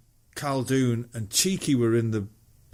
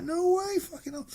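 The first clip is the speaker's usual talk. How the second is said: amazed disbelief. Retold or imitated. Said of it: "He's like".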